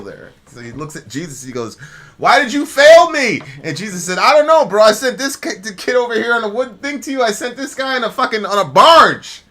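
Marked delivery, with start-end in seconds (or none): 2.2-3.4 high, loud outraged question; indignant accusation. Retold or imitated. Drowned man in heaven confronting Jesus, loud and high. 4.2-9.4 high, loud exasperated bro voice; exasperated Jesus. Retold or imitated. Jesus telling the drowned man he sent help, high and exasperated.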